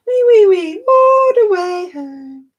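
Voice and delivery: very high, loud squeal, then a slow drawn-out "all the way home"; squealing piggy. Acted. Ending the nursery rhyme for friends as a high squealing piggy.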